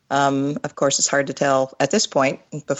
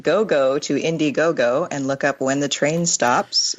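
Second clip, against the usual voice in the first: ordinary talk, nothing marked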